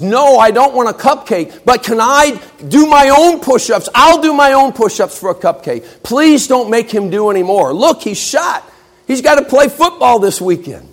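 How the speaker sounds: a distraught student begging the professor; pleading protest. Acted. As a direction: plead to stop the punishment. Crying girl pleads for Pete.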